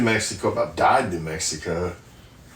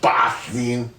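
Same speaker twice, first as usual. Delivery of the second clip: a quick mock Boston accent on "Boston", broad open vowel as in "Bahstin"; 2 semitones higher than usual. Reading out order locations, mocks a Boston accent. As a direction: toss off "Boston" in a joking Boston accent. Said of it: "Had to do my Boston accent".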